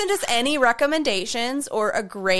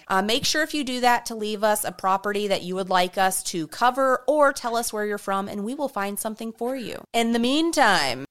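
ordinary delivery

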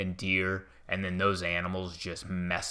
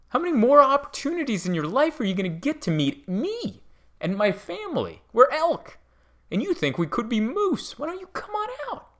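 squeaky high cartoon elk, coaxing; a sweet little elk luring the tourist closer; 7 semitones higher than usual. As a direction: animal voice, much higher, wheedling and playful. Voicing the elk inviting him.

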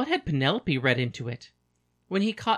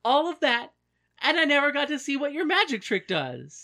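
excited; 10 semitones higher, 4 dB louder than usual. Acted. Odysseus excited, high.

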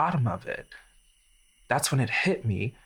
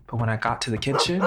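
plain reading voice

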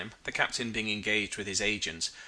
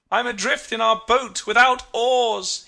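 slow, high, loud distress call; plaintive shout across the water; crying out; 11 semitones higher, 9 dB louder, slower than usual. A narrator's reading. Captain crying to his crew from the drifting boat, high shout. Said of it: "he cried".

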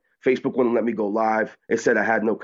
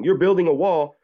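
raised, higher mock scream; retold scream; 7 semitones higher than usual. Protester recounting screaming back at crowd, mock scream. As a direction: call it out high, reenacting a protest chant-back. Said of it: "when I start screaming".

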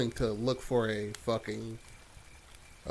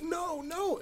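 very high, thin mock wail; retold pleading cry; 4 dB quieter than usual. Pizza guy as the frog comes closer, high pleading. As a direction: squeal it as a helpless victim.

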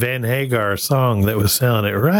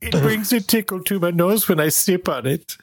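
effeminate German voice; 7 semitones higher than usual. Pretend. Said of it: "effeminate Nazi inside you".

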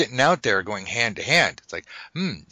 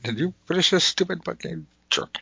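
mock heckler; 8 semitones higher than usual. Imagined angry listener's hate mail.

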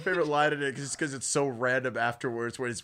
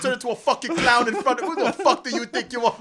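mock tough kids; 8 semitones higher, 6 dB louder than usual. Kids confronting Pennywise.

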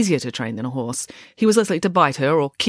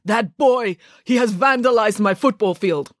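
put-on higher, indignant amateur Russian accent; angry Russian accent; 6 semitones higher than usual. A narrator's reading. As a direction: accuse someone in a rough Russian accent. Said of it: "when he was angry, he often thought in that language".

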